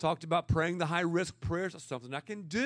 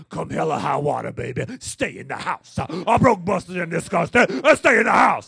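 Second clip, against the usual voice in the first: preaching like Bishop Jakes; 13 dB louder than usual. Retold or imitated. Bishop Jakes in full flight preaching to the congregation, rising to a shout. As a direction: hammer it like a revival preacher, louder each line. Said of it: "Bishop has this thing".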